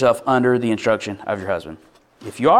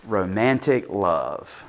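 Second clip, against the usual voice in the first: lower, softer, slow answer: Romantic love; quiet punchline; 5 semitones lower, 5 dB quieter, much slower than usual. Real, not acted. Preacher answering his own question, quiet and slow. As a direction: drop it low and slow as the punchline.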